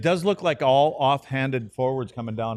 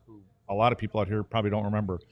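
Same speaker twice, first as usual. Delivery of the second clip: normal talking voice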